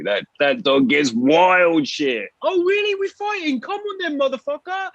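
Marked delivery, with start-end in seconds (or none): 2.4-4.9 much higher squeaky taunt at the dog; hammed-up trash talk to the dog; squeaky tough-guy challenge; mock high taunting voice. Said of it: "something to talk shit to".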